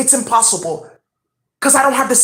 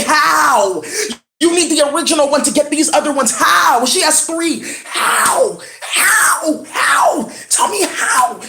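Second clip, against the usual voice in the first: rising, pitched-up demanding shout; mock lab shout; 4 semitones higher, slower than usual. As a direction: hammer the question like a baffled scientist. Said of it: "somebody's gonna see this in the lab and say".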